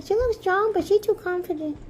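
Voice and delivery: gossipy voice for the chickens. Pretend. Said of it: "They're like".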